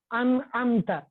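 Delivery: ان said with the nun fully clear, then امتا; demonstrating a clearly pronounced nun in ان امتا; clear izhar demo. Shown as a demonstration. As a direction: sound out the nun plainly in ان and امتا. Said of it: "ان کو بالکل ظاہر کر کے پڑھیں گے".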